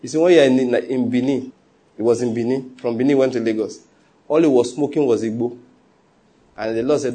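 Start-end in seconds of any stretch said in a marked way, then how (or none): none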